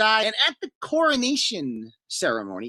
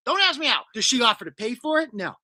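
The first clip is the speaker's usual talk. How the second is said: ordinary delivery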